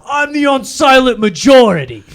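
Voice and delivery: high, loud, put-on Paulie bluster; hammed-up Paulie line; Paulie impression. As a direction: boom it out like proud Paulie. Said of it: "He goes".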